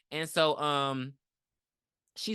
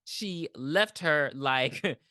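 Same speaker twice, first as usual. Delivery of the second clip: ordinary delivery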